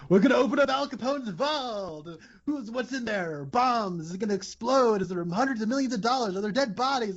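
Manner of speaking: high-pitched mock TV showman hype; hyped-up spectacle. Reporter hypes a vault opening to viewers, hammed-up spectacle. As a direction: imitate a sensationalist reporter teasing a huge reveal. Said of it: "made, like, a big spectacle".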